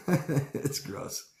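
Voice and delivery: laughing it out in disgust. Real, not acted. Speaker laughing at how gross it is, laughing. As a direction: laugh through the words.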